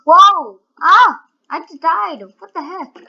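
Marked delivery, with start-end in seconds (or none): 0.0-1.2 loud startled whoa; startled yell. Real, not acted. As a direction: yell in surprise. Dies suddenly in game.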